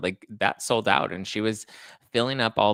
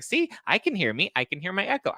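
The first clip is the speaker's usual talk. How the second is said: high-pitched, obliviously pleased impersonation; mock clueless mom; oblivious mother; 10 semitones higher than usual. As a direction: insist on it in a raised, lilting pitch. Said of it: "she was just totally oblivious to it".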